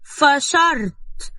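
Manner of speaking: high, long-drawn scoff; scornful dismissal. A narrator's reading. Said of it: "لوت نبيلة شفايفها وقالت وهي بتشوح". (فشرت ممدودة باستنكار)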